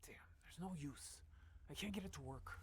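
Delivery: muttered under the breath, annoyed; frustrated whisper. Acted. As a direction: whisper it, fed up with the broken thing. Frustrated, working quietly.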